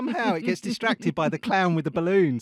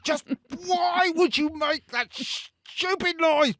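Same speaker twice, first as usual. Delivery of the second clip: high, loud, slow exasperated scolding; mock enraged; 12 semitones higher, 5 dB louder, slower than usual. Scolding herself for her own sneeze, mock enraged. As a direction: rage at your own sneeze, drawn out. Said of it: "utterly enraged at my own".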